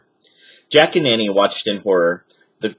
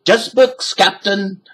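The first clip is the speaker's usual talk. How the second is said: Stinky shouting; 11 semitones higher, slower than usual. A narrator's reading. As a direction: shout down from the tree. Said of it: "shouted Stinky".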